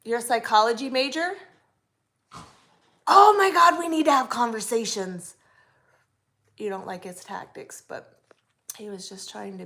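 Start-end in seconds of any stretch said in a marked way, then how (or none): 3.1-5.4 much higher and louder squeal of delight; thrilled to meet a fellow psych fan; excited burst. Real, not acted. Excited that viewer studies psychology.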